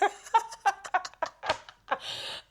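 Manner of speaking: long, high peal of laughter; helpless laughing fit; laughing in recognition. Real, not acted. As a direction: laugh hard because you've been there. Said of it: "I'm laughing 'cause I've been there".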